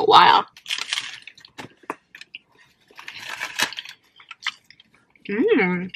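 chewing while talking; speaking between bites; mouth full. Real, not acted. Seen 0: bites taco at -5; mouth still working early, then licks fingers.

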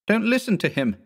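high, urgent yell. A narrator's reading. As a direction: cut him off, shout it. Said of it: "I yelled".

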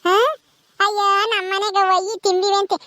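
put-on high-pitched little kid voice; well-meaning confused child. Child mishears the phrase and consoles aunty, childlike. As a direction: console her like a muddled small child.

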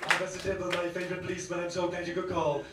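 quiet Top Cat. Retold or imitated. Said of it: "Reservoir Cats".

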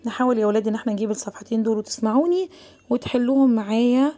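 ordinary delivery

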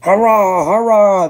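huge high triumphant cheer; roaring. A narrator's reading. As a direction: roar it as a runaway mob. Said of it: "they roared".

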